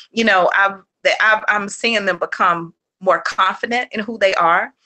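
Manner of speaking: ordinary talk, nothing marked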